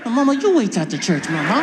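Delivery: loud, voiced comeback; cheeky, emphatic echo of a parent; defiant son. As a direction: throw the threat back at full voice. Son throwing threat back at mother.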